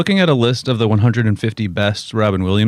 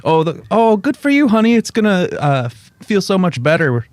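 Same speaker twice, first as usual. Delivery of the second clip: high, sweet doting mother; mom voice; 7 semitones higher than usual. Retold or imitated. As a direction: coo it like a proud mom. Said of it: "Mom was like".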